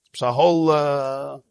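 ordinary talk, nothing marked

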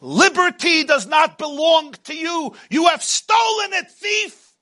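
high shouted accusation; accusing shout. Acted. Accuser yelling that liberty was stolen, high shout. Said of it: "and somebody says".

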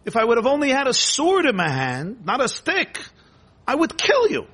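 acted outrage climbing high; angry threat. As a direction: build to a high-pitched threat to kill. Bilam threatens to kill donkey.

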